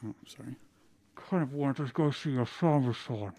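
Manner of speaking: muffled, words blurred against the pillowcase; muffled into a pillow. Acted. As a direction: talk as if your face is pressed into a pillow. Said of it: "his voice muffled against the pillowcase".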